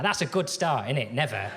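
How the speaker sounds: ordinary delivery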